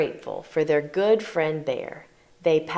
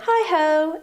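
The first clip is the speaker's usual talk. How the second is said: cheery call; 8 semitones higher, 3 dB louder than usual. Acted. Hare calling at door.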